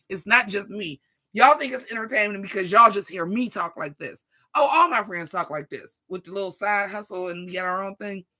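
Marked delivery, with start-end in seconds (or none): none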